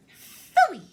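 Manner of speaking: loud, high disgusted outburst; disgusted Phooey. Acted. Antoinette rejecting all things pink, loud and disgusted. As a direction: spit it out in disgust.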